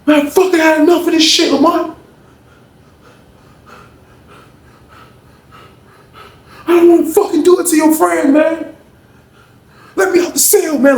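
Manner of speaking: high-pitched, fed-up protest; acted angry demand. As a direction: act out someone demanding to get out. Sean demands to be let out.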